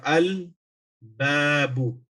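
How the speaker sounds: slow, deliberate al-babu with the lam sounded; careful Arabic demo. Shown as a demonstration. Teacher slowly sounding al-babu for students. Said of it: "For example".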